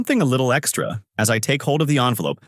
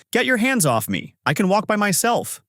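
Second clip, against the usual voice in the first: much higher, pushing yell; 10 semitones higher than usual. Acted. Manager Park, being led out by staff, yelling. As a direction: shove off the guards. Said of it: "Manager Park yelled".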